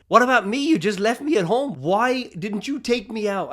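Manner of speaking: mock wife demanding what about me; retold complaint. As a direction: voice the wife's hurt protest. Wife complaining in his story.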